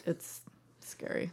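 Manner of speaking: a small scared whisper. Acted. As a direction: hush it like a confession. Mock dread at eating all.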